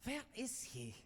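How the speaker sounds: high, lightly put-on German accent, stalling; a fairy-tale lady in a hammed-up German accent, vamping. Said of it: "in her little German accent".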